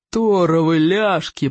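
slow, growling curse; growled oath. A narrator's reading. Teacher urges his mount on, slow growl. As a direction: growl it out, stretching the words. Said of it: "прорычал Плевака".

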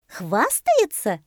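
surprised monkey, high, loud, drawn out. A narrator's reading. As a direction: echo it in amazement, voice jumping up. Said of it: "удивилась мартышка". (удивлённо, высоко)

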